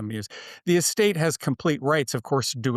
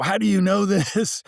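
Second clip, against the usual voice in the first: higher-pitched mock yelling, laugh breaking in; imitated yell; 7 semitones higher, 4 dB louder than usual. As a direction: act out his angry outburst, half laughing. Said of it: "he would yell at you".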